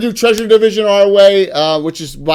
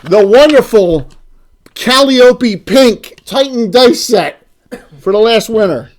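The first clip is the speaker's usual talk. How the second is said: slow, loud showman prize reveal; game show host; 4 semitones higher, 7 dB louder, slower than usual. Acted. Hyping up the last prize.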